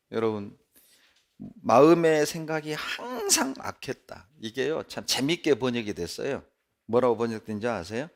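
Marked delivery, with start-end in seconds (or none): none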